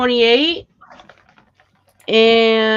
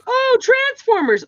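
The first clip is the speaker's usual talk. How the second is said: put-on kid voice; 4 dB louder than usual. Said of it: "they're like".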